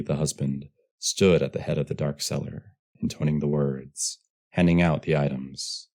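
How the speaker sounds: ordinary delivery, nothing marked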